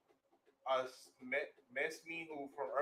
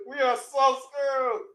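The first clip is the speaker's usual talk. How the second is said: high, loud, slow crying laugh; laughing despair; 10 semitones higher, 13 dB louder, slower than usual. Real, not acted. Laughing at the wrecked courtyard, mock wail.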